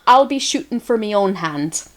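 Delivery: put-on amateur Scottish movie-quote accent. Quoting the Disney movie Brave on request, Scottish accent. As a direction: attempt a line from Brave in Scottish. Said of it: "My Scottish, uh, all boils down to one phrase".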